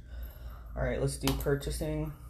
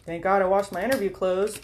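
louder, higher sarcastic quip; bitter irony, voice raised; 6 semitones higher, 8 dB louder than usual. Real, not acted. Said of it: "I need to stop with that attitude".

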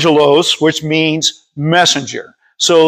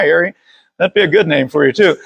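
usual speaking voice

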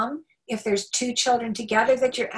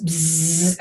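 buzzing fly; 5 semitones lower, 4 dB louder than usual. Retold or imitated. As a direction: mimic a fly's buzz. Imitating a fly's buzz.

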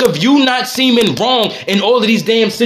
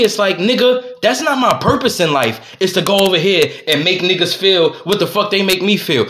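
normal talking voice

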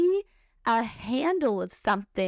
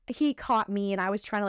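ordinary talk, nothing marked

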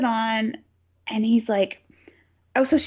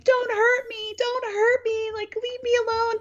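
mock scream; 12 semitones higher than usual. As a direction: imitate a hysterical victim without full volume. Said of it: "Lisa just starts screaming".

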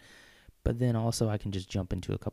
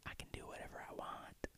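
whispering; 19 dB quieter than usual. Real, not acted. Playful aside to listeners.

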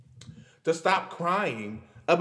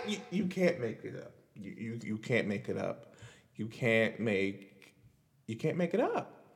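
disbelieving murmur; 7 semitones lower, 8 dB quieter than usual. Real, not acted. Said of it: "repeated in disbelief".

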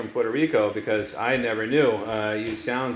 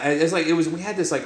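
ordinary delivery

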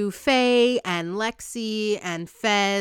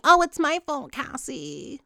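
fake guilty Nate; 7 semitones higher than usual. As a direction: lay on the guilt to fish for sympathy. Manipulative Nate quote.

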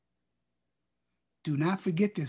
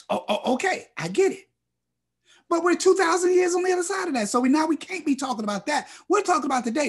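fast, higher, rushing ahead; fired-up preaching; 4 semitones higher, faster than usual. Acted. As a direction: build the momentum, tumbling words out. Preacher speeds up, rising pitch.

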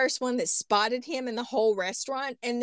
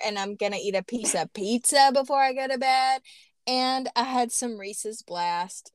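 ordinary talk

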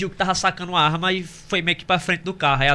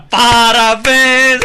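loud, slow drawn-out cheer; surprise party shout; 6 semitones higher, 8 dB louder, much slower than usual. Retold or imitated. Friends shouting congratulations at the birthday dinner. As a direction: stretch it like a crowd yelling surprise. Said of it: "veio a galera".

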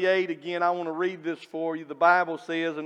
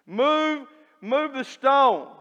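higher, slow, forceful order; booming command; 6 semitones higher, 3 dB louder, much slower than usual. Retold or imitated. Preacher booming Jesus's order at the tomb, higher and slow. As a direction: call out the command like the Lord himself. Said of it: "the command from the Lord himself".

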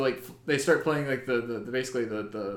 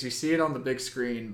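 normal talking voice

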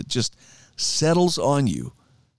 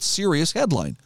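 ordinary talk, nothing marked

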